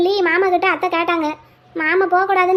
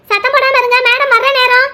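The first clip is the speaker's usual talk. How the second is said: loud, raised-pitch order to a waiting room; higher, louder crowd-hushing announcement; calling for silence; 5 semitones higher, 4 dB louder than usual. Acted. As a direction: call out firmly over the noise. Nurse hushes a noisy waiting room.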